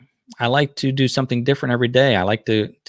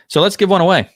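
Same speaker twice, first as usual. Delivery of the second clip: excited; 9 semitones higher, 7 dB louder, faster than usual. Real, not acted. Host announcing stream giveaway.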